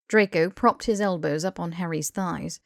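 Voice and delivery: ordinary delivery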